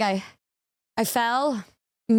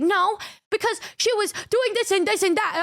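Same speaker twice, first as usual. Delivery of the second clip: higher, insistent mock of Michi; 8 semitones higher than usual. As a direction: play her stubborn excuse pitched up. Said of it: "she's like".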